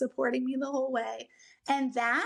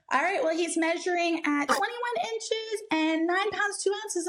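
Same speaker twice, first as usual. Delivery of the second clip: announcing measurements; 6 semitones higher than usual. Retold or imitated. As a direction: lightly imitate staff announcing the baby's figures. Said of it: "when they announced his weight and his height".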